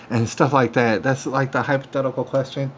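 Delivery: ordinary delivery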